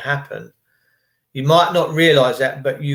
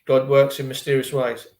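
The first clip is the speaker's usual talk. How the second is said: ordinary talk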